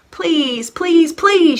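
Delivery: put-on high, loud pleading child; begging. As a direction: beg like a little girl who wants snow. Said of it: "she begged".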